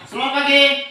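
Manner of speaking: "Selamat pagi" called out high and loud; called greeting. Shown as a demonstration. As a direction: sing out the greeting to the class. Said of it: "Jadi kalau Bapak bilang". (salam "Selamat pagi" diserukan tinggi dan keras)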